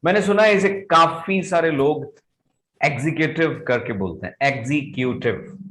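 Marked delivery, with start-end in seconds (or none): 2.8-3.6 "एग्जीक्यूटिव" as "ek-zee-kyu-tiv"; "ek-zee" opening in "एग्जीक्यूटिव"; letter-by-letter mispronunciation. Shown as a demonstration. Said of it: "एक एक letter को बोलना". 4.4-5.6 "एग्जीक्यूटिव" as "ek-zee-kyu-tiv"; each syllable sounded out in "एग्जीक्यूटिव"; letter-by-letter mispronunciation. Shown as a demonstration. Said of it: "एक एक letter को बोलना".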